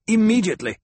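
loud, high, cutting insistence; insistent command. Acted. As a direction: cut off the objection sharply. Lady cuts off objection with order.

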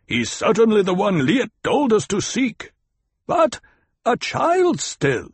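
high, emphatic calling; forceful high-pitched declaration. A narrator's reading. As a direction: argue it loudly across the rocks. Argument shouted between leader and rocks.

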